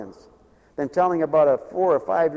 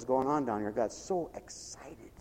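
softer, slower, half-whispered; a slow hushed aside, much softer; 4 semitones lower, 11 dB quieter than usual. Real, not acted. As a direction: let it fall to a whisper.

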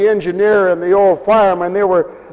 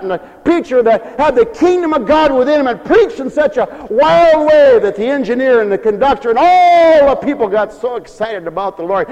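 normal talking voice

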